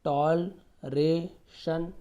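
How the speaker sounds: Toleration drawn out slowly, each syllable apart. Shown as a demonstration. Teacher breaking toleration into slow syllables. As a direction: spell out toleration slowly for the class. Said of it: "तोड़ तोड़ के पढ़िए आप".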